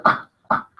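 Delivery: short unvoiced throaty "ah, ah"; throat-clearing ah. Shown as a demonstration. Teacher likening vowel to stuck throat, throaty voiceless ah. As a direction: dislodge something stuck in the throat. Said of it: "algo atorado ahí en la garganta".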